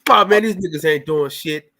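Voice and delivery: ordinary talk, nothing marked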